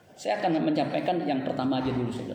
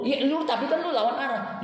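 retort; 9 semitones higher, 3 dB louder than usual. Retold or imitated. Pastor retorting to the driver.

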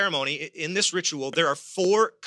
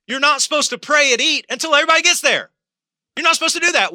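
emphatic preaching; 11 semitones higher, 8 dB louder, much faster than usual. Acted. Preacher scolding about Thanksgiving.

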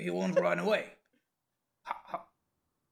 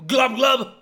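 gurgling fish man croak; 8 semitones higher, 9 dB louder than usual. Acted. Homunculus meatball speaks.

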